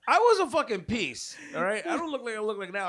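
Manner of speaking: loud, high-pitched outburst; indignant protest. Real, not acted. Man defending his old looks to teasing friends, loud indignant protest. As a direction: protest loudly over the teasing.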